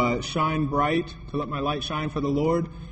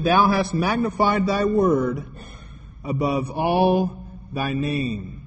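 long pause, then "above all thy name" very slow, stretching "all"; emphatic verse reading; 2 semitones higher, much slower than usual. Shown as a demonstration. Preacher shows congregation where scripture puts emphasis, deliberate stress. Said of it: "Let's put the emphasis where God's word".

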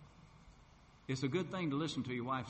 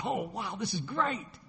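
high, thrilled exclamation; excited; 7 semitones higher, 3 dB louder, slower than usual. Retold or imitated. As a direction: re-enact sudden delight, pitched up. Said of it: "And I got excited".

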